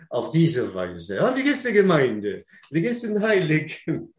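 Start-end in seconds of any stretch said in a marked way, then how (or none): 1.2-4.1 higher, fast, chirpy mock hello; mock greeting. Preacher mimicking a church greeting, higher and quick. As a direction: play a cheery churchgoer greeting a friend.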